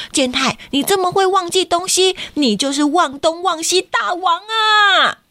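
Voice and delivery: high, slowed cheering shout; cheering kid. Acted. A classmate shouts Kenta is the forgetful king. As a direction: crown him king with a shout.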